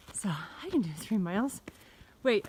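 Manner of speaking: normal talking voice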